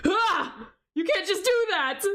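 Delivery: high startled cry of protest; startled yelp. Real, not acted. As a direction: jump at the scare, then protest. Startled by jump scare.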